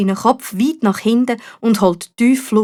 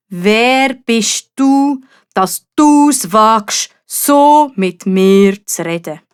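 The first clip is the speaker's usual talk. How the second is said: slow, booming dragon; menacing slow growl of a dragon; 4 dB louder, much slower than usual. A narrator's reading. Dragon challenges the knight. (langsame, drohende Drachenstimme)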